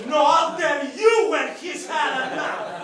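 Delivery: high, loud, slow put-on dad voice; dad retorting. Dad answering the mom about whiskey, high loud slow mock voice. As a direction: snap back like a stubborn dad. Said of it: "dad answering mom".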